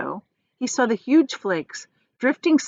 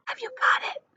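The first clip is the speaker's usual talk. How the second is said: much higher hushed question; excited whisper; 5 dB quieter than usual. Acted. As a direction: whisper it with bright hope. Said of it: "whispered Grandpa Joe".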